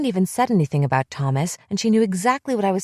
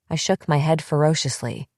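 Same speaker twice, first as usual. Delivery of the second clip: ordinary delivery, nothing marked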